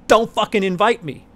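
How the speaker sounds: loud indignant snap; angry outburst. Real, not acted. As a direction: spit it out, fed up. Ranting about screener exclusion.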